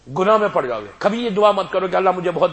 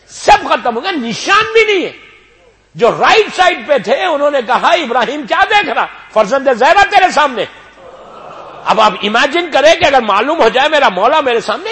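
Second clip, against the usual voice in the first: high, loud rising sermon climax; full-force preaching; 11 semitones higher, 10 dB louder than usual. Acted. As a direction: lift the hall with the revelation.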